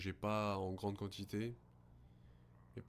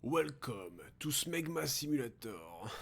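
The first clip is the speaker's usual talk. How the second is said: slow higher game-announcer voice; mock announcer; 5 semitones higher, 3 dB louder, much slower than usual. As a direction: ham up a game intro.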